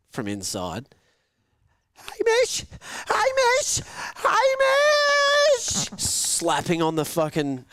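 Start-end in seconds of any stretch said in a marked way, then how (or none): 2.0-5.9 put-on high, loud child calling out; little sister calling. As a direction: shout it like a small girl at the window. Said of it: "Tilly goes".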